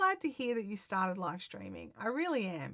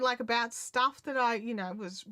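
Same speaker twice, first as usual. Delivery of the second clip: ordinary talk, nothing marked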